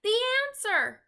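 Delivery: very high, loud eager cry; thrilled shout; excited cry. A narrator's reading. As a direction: burst out with the answer. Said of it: "cried Sophie".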